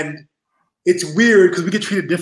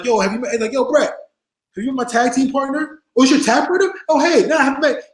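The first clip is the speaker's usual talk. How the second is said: hammed-up eager intro; 9 semitones higher than usual. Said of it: "I'm like".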